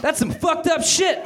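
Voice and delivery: high, drawn-out mock yell. Meg reacting to the evil brain's takeover, high mock yell. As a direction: yell it high without extra volume. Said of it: "Meg yelled".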